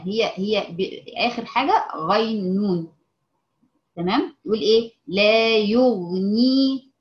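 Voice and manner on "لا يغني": يغني stretched very slowly and loud, with the ya lengthened; demonstrating the mistake; added ya. Shown as a demonstration. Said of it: "وتزود الياء".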